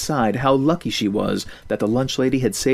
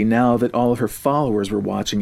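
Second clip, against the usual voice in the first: ordinary delivery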